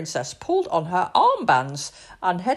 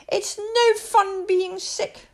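moaning princess; 12 semitones higher, 3 dB louder, slower than usual.

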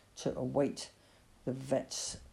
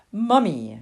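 firm correction; 4 semitones higher, 7 dB louder than usual. Acted. Mother corrects child firmly.

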